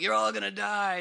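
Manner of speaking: loud, menacing prophecy of doom; ominous doom voice. Retold or imitated. The fear spirit threatens the Enterprise crew, loud and menacing. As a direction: boom it out like a possessed ship's voice. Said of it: "the voice was like".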